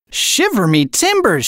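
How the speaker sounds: thrilled to find a pirate message; excited shout. Acted. Boy reading a pirate message, excited cry. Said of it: "shouted Lee".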